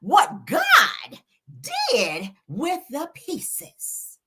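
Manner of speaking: high, slow imitation with stretched words; Mel's voice going up. Imitating how Mel likes to talk, high and drawn out. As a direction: mimic her rising voice, drawing out each phrase. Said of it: "she like to do that".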